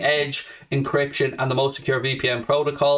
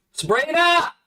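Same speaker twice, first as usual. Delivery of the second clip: calling out to wife; 13 semitones higher, slower than usual. Pretend. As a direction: shout her name, pitch leaping up high. Calls out to his wife.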